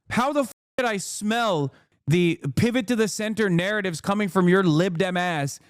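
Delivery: ordinary talk, nothing marked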